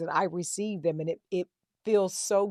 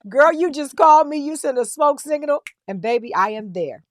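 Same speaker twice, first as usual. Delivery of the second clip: higher and much louder, gushing; excited promise; 7 semitones higher, 9 dB louder than usual. Real, not acted. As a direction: hype her up like a best friend. Promising to show up anytime.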